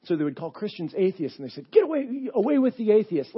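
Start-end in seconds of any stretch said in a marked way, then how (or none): 1.7-3.4 high, loud mob cry; shouting crowd calling for blood; mock mob shout. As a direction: yell it like an angry crowd. Mob shouting for Christians' death.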